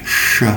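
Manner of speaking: "Sh" with rounded lips. Shown as a demonstration. Teacher showing the English sh sound. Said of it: "is rounded in English".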